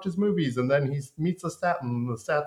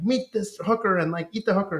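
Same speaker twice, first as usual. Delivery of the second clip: mock Lestat; 10 semitones higher, 4 dB louder than usual. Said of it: "and then, like".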